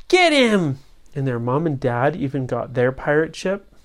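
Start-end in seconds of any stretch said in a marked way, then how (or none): 0.1-0.8 call to chase. Acted. Parents chase pirate ship.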